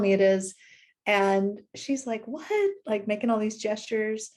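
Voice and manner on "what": puzzled what. Retold or imitated. Housekeeper confused by request.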